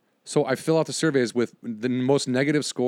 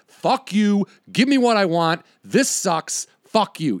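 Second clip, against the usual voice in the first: louder, higher ranting; mock angry complainer; 5 semitones higher, 4 dB louder, slower than usual. Angry customers yelling at companies, mock rant. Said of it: "everybody else is like".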